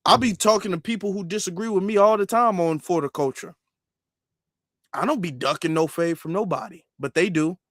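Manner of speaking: ordinary talk, nothing marked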